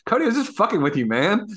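rattled off quickly, teasing; fast teasing confession. Retold or imitated. Telling Cody it was a joke, fast teasing. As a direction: rush it out like a playful prank reveal. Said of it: "I was like".

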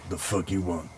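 low, quiet, hostile grunt; gruff sailor. Acted. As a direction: mutter it like a surly brute. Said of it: "he's like".

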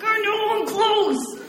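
exasperated. Retold or imitated. Teen caller groaning back at the radio DJ, whiny. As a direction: stretch it out, fed up and pleading. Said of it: "And I'm like".

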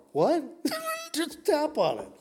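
high, loud mock surprise; mock indignant. Husband mocking his wife's suggestion, high and loud. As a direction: play the stung husband.

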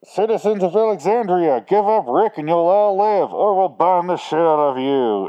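loud, high-pitched villain's proclamation; propaganda announcement. Retold or imitated. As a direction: proclaim it like propaganda to a whole town. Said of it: "he's doing his, like, fucking propaganda".

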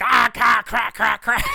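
hammed-up creatures begging for crack; crack-craving monsters. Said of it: "coming at Zorro like".

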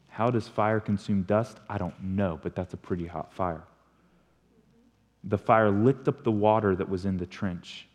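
normal talking voice

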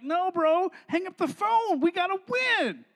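mock heckling from the boys; impatient teammates. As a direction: call out pleadingly to your buddy. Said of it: "the boys are over here like".

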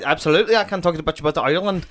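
cheery put-on Irish brogue; Irish accent. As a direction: do your best impression of an Irishman. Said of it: "give us your best impression of him".

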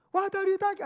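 high, loud woman's interruption; indignant outburst. Retold or imitated. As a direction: cut in shrilly and loudly as the upset lady.

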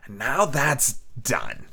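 soft, slow, menacing beggar; cruel cold voice. A narrator's reading. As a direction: whisper the threat coldly. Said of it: "a voice so cruel and cold and ugly".

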